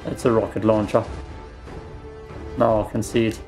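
normal talking voice

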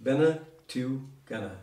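"Bene tu gana" said slowly, each syllable drawn out; slow it down so the learner catches "Bene tu gana". Shown as a demonstration. Teacher repeats "Bene tu gana" slowly on request. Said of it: "Can you pronounce that again?".

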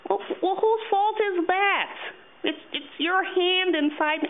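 very high puppet voice, indignant. Acted. Puppet blaming the hand inside, high indignant voice.

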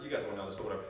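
ordinary talk

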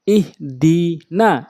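each syllable set apart; slow demo. Shown as a demonstration. Teacher reading so learners can follow, slowly. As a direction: break the word into long, slow pieces. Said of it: "slow বলছি এই জন্য".

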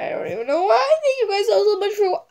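high, loud and teary, half laughing; laughing through tears. Real, not acted. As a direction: laugh while choking up. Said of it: "I'm about to cry".

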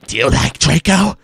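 loud, high put-on voice; hammed-up nosy friend; teasing friend. A narrator's reading. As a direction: ask it big and high. Gossiping friend's line, acted.

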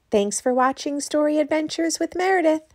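loud, bright show sign-off; announcer outro. Acted. Host closing the children's show, loud announcer sign-off.

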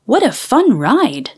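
ordinary delivery

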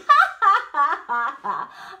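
very loud, high laugh; gloating guffaw. Acted. Horse races off sure of winning, gloating laugh. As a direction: roar with boastful laughter.

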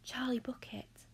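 soft, timid whisper; hushed, shy little voice; small nervous whisper. A narrator's reading. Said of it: "a small nervous voice whispered".

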